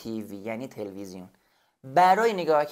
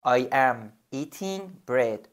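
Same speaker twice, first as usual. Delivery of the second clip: "I am eating bread" spoken very slowly, each word separate; slow model sentence; much slower than usual. Shown as a demonstration. Teacher to student, a slow model sentence. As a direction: model it slowly for the learner to repeat. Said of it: "بگو".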